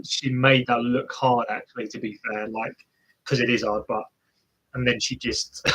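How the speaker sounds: ordinary delivery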